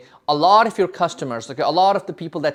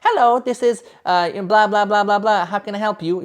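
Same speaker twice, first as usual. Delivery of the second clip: imitating receptionist; 3 semitones higher than usual. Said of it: "They're like".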